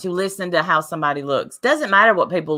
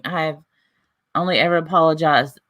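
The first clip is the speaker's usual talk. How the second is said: ordinary talk, nothing marked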